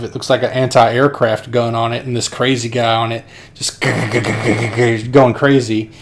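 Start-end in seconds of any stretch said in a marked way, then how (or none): 3.9-5.1 throaty rapid-fire gun noise; imitated machine-gun rattle. Crazy guy firing the gun, rattled gun noise.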